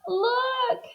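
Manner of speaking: high, drawn-out gasp of a word; Missus Watson. A narrator's reading. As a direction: call it high and hushed. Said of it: "said Missus Watson".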